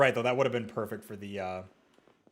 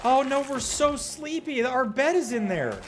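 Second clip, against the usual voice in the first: whiny dismay; 12 semitones higher, 4 dB louder than usual. Real, not acted.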